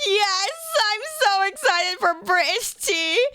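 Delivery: high-pitched, giddy mock plea; gushing excitement. Streamer retelling her reply to friend bringing British tea, gushing. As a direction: gush like an overexcited fan.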